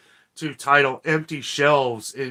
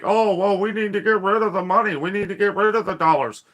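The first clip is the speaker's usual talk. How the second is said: higher, put-on voice of the cashless crowd, sneering; mocking voice; 6 semitones higher than usual. Vlogger mocks the people killing cash, in a sneering higher put-on voice. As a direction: lift the voice and sneer their excuse back at them.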